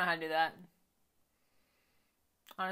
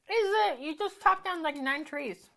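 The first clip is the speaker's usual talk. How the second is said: high disbelieving is it; mock amazed at the axe; raised pitch of disbelief; incredulous; 8 semitones higher than usual. Real, not acted. Incredulous at cartoon.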